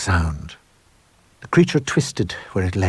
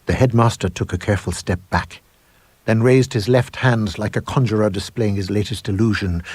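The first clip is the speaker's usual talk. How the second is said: usual speaking voice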